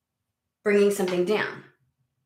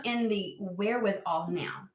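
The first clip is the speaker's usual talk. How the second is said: ordinary talk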